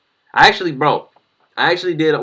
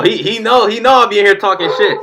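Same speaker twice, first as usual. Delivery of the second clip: hyped and louder; excited; 9 semitones higher, 7 dB louder than usual. Real, not acted. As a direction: raise it up, hyped. Hyped boast about rival.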